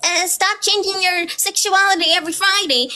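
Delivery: a hint of a preachy commenter; mocking a commenter. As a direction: parrot the commenter back in a sneering voice. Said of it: "somebody said".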